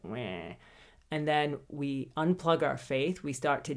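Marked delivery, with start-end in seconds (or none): 0.0-0.5 deflated meh. Pretend. Giving up, deflated.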